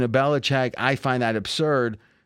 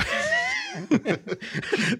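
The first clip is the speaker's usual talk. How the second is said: high-pitched laugh; 4 dB quieter than usual. Real, not acted. Laughing at own impression.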